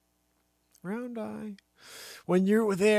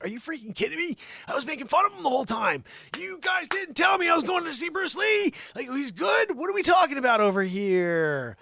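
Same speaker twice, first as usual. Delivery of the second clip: high, loud disbelieving yell; incredulous shout; 12 semitones higher, 7 dB louder than usual. Retold or imitated. Finding out the kid was Bruce Lee, a high shout.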